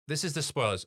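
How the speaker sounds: ordinary talk, nothing marked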